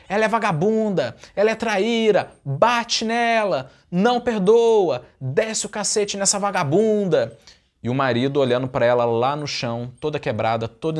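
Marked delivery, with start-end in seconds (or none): 0.1-7.4 high, slow mocking yell at the cheating wife; jeering crowd insults. Crowd jeers at the betrayed husband, high slow mock yell. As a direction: drag out the insults like an angry mob. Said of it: "começa a ecoar vários xingamentos".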